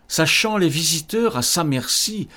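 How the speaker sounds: usual speaking voice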